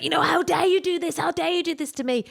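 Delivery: high, anguished accusing cry; raw rage. Retold or imitated. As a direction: hurl it at the abuser. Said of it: "really primitive rage was coming out".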